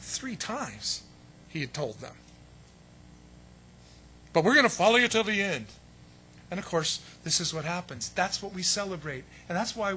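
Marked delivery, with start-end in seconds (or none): 4.3-5.7 mock bravado. Mocking disciples' earlier promise.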